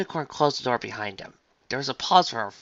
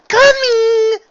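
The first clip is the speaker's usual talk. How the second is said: high, loud singsong call; 14 dB louder than usual. Acted. Shop owner answers a knock at the door, calling out. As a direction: sing it out toward the door.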